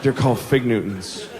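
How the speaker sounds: low, measured parental reply; restrained, low-pitched insistence; firm dad correction. Acted. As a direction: contradict the child in a low voice. Dad firmly correcting child.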